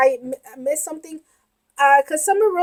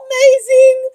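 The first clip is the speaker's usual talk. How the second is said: real overwhelmed fan; awestruck; 3 semitones higher, 8 dB louder, slower than usual. Real, not acted. Raving after emotional scene.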